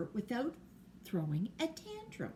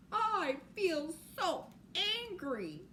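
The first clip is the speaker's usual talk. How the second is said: angry puppy; 6 semitones higher, slower than usual. Acted. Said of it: "says the little puppy".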